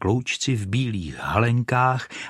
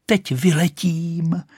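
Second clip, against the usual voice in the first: high, drawn-out triumphant cry; 8 semitones higher, slower than usual. A narrator's reading. Rocket feels herself about to fly, crying out. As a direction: stretch the words, pitched high. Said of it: "křičela".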